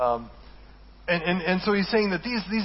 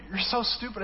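mock exasperation; 6 semitones higher than usual. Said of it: "I just wanted to yell at them".

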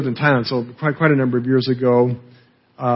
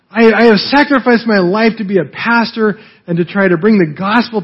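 high, raised, indignant complaint; pleading and protesting, voice pitched up; angry at God; 5 semitones higher than usual. Retold or imitated. Pastor venting his frustration at God, raised and indignant. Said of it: "getting really angry at God".

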